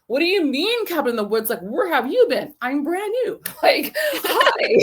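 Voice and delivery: high, sweet wide-eyed voice; high chirpy brand new hi with laughs; mock naive newcomer. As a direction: play innocent and brand new. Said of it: "They're like".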